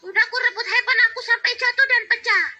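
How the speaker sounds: put-on a child tattling on mom; whining little girl. Child complains about mother. (anak kecil mengadu merengek)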